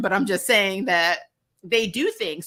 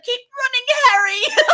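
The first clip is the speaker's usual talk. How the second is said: cheering shout; 6 dB louder than usual. Retold or imitated. Cheering on Harry in the chase.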